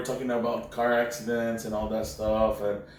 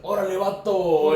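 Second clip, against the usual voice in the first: mock Chicano slang; 7 semitones higher, 5 dB louder than usual. Cop speaking Mexican slang.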